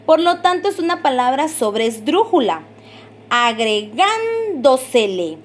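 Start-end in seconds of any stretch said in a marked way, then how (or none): 3.3-5.4 "Agregándosele" slowly, with a long, held "gán"; stressed syllable demo. Shown as a demonstration. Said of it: "se escucha el golpe de voz". ("Agregándosele" lento, alargando la sílaba "gán")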